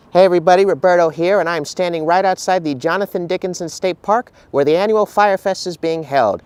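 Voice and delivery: upbeat field reporter intro. Acted. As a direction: present it like TV news. Field reporter opening segment.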